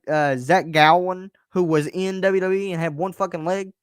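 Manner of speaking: ordinary talk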